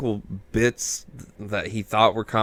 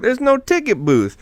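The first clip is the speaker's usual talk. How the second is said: high-pitched, puzzled mock remark; lightly voiced old man; 13 semitones higher, 3 dB louder than usual. Podcaster quoting the old man at the tent, high mock voice. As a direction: pitch it up, imitating a baffled character. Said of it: "He's like".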